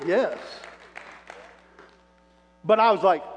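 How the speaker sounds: ordinary delivery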